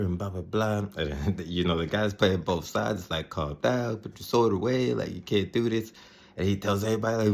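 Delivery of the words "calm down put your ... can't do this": urgent appeal. Retold or imitated. Retold peacemaker intervening in confrontation.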